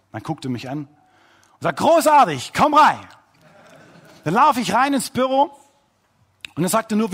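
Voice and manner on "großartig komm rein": excited welcome. Retold or imitated. Boss welcomes pastor in.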